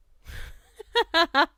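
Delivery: bursts out laughing hard; big helpless laugh; loud real laugh. Real, not acted. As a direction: cackle loudly at the chat. Streamer laughing at chat.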